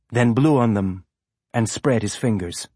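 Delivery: ordinary delivery, nothing marked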